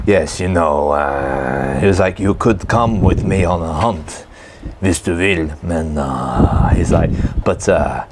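a hint of a Norwegian accent; lightly imitating a Norwegian hunter. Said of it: "do a Norwegian accent impression".

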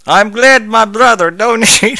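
high, loud Southern drawl with a Russian edge; Southern Russian accent. Acted. Reader voicing Applejack in a Southern Russian accent. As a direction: reads a character in a Southern Russian accent, hammed up. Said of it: "how am I gonna do Southern Russian?".

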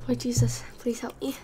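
desperate whispered prayer; whispered plea. Real, not acted. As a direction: breathe it out, begging for help. Pleading whisper while eating spice.

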